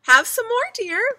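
high, loud sing-out offer; doting mother. A narrator's reading. Mother offers toast in her sleep, high doting voice. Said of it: "Missus Watson said in her sleep".

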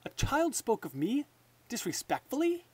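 high rising disbelieving question; can't believe what he hears; disbelief. Acted. King Fred learning a child insulted him, incredulous rise.

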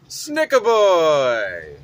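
slow, loud announcement; dramatic reveal. Acted. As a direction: call the name out like an announcer.

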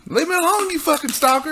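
fed up, shooing him away; high exasperated whine. Retold or imitated. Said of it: "I was like".

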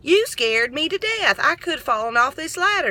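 high alarmed cry of surprise, retold; startled high-pitched protest; startled yelling. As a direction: shriek it like she just got pinched on a ladder. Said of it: "I screamed and I turned around and I said".

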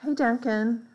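plain reading voice

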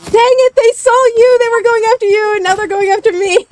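loud high panicked yelling; excited shouting. Real, not acted. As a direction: yell it as they chase you. Chased by enemies in game.

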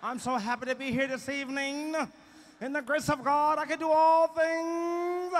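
mock testifying that builds to a high, loud wail; church testimony. Church singer thanking pastor, members and friends, building to wailed praise.

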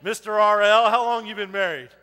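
ordinary delivery

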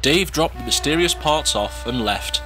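usual speaking voice

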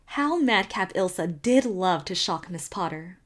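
ordinary delivery

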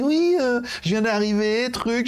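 high, put-on voice of a newcomer rep; mock brand rep. Mocking the Oukitel rep who wants half price, high and put-on. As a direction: lightly parody the rep asking for half price. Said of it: "On me dit".

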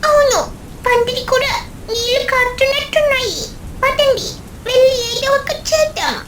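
put-on high, bright-eyed little kid voice; child voice. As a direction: speak like an eager small child. Child urges fixing leaking pandal. (చిన్న పిల్లాడి గొంతు)

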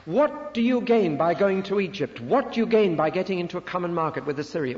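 loud, high, insistent questioning; direct preaching. Acted. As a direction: fire each question at the congregation like a prosecutor. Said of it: "This is very direct preaching".

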